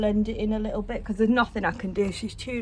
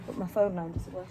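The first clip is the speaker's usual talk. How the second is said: normal talking voice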